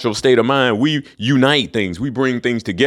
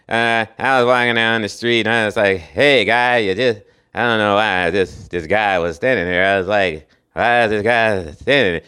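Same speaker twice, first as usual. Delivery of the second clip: Norm Macdonald impression; 4 semitones lower than usual. Mocking the last Colonel actor with a lightly lowered deadpan ramble. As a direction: imitate a dry comedian rambling an anecdote. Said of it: "He always talks like this".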